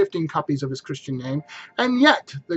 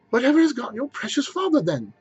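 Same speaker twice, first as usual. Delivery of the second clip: higher, lightly voiced fussing mother; mother fretting; 8 semitones higher than usual. Mrs Cratchit frets to her children, lightly voiced. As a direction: worry aloud about the late father. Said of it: "said Missus Cratchit".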